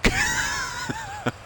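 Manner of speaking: a sudden high burst of laughter. Acted. NPC laughing at player's question.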